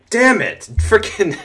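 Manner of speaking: high, loud curse; angry outburst. Real, not acted. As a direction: spit out the curse in frustration. Opponent runs over him.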